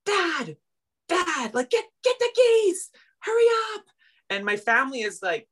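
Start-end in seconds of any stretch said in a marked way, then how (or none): 0.1-3.8 high panicked shout to dad; high frantic retold scream. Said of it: "screaming at everybody".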